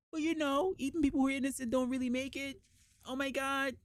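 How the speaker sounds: high-pitched weepy imitation; mock tearful. Tearful team to colleague about innocent people, mock weeping. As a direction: wail it lightly like a tearful coworker. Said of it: "they were in tears".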